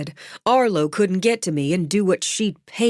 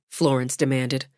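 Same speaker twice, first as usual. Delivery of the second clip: ordinary delivery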